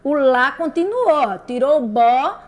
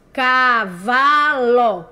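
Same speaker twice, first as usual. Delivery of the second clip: "Cavalo" very slow, in three stretched beats; loud-stressed, separated syllables in "Cavalo"; 4 dB louder, much slower than usual. Shown as a demonstration. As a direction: sound out "Cavalo" as cá, vá, ló. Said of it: "Qual é a sílaba inicial?".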